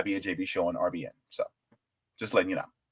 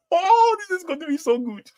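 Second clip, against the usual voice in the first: much higher, excited Nigerian voice; Nigerian accent. Pretend. Streamer gushing over a new book, in a Nigerian accent. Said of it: "how was my Nigerian accent?".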